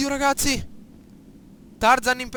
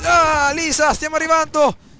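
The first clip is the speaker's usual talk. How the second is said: calling out; 4 semitones higher, 8 dB louder than usual. Real, not acted. Calling out to Lisa in game.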